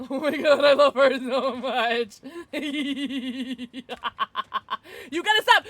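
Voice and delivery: loud, high laughing through the words; laughing fit. Real, not acted. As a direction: crack up in delight. Laughing at a teammate.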